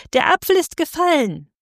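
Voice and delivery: hoher, jubelnder Ruf der Menge. A narrator's reading. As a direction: als hundert Stimmen jubeln, viel höher. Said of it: "hundertstimmig jubelte das Volk". (das Volk jubelt hoch und laut)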